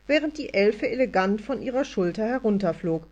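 ordinary delivery, nothing marked

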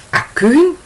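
shrill high startled question, rising sharply; wife at the door suddenly recognising her husband, pitch jumping high; voice shoots up. A narrator's reading. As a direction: her voice leaps way up on the name in disbelief. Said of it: "Die Stimme seiner Frau steigt um mindestens zwei Oktaven".

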